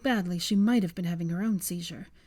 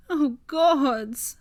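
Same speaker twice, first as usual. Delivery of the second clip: higher and louder cry; 6 semitones higher, 6 dB louder than usual. A narrator's reading. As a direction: cry out to the heavens. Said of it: "Hermione cried".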